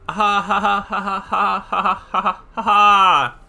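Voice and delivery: loud, high staccato ha-ha cackle; mad laugh. Shown as a demonstration. Showing how Junko laughed, loud mad cackle. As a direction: bark out each ha like a maniac. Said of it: "That's exactly how it sounded like".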